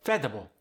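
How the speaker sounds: "Vegetable" shortened to veh-duh-bul; Khmer-style "Vegetable" as veh-duh-bul; fewer syllables. Shown as a demonstration. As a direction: drop the extra syllable from "Vegetable". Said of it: "extra syllables".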